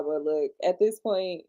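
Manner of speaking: ordinary delivery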